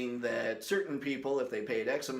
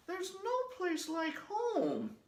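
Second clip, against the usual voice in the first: put-on very high, slow girlish voice; wistful little girl, dragged out; Dorothy impression; much slower than usual. Vlogger doing Dorothy very high and slow.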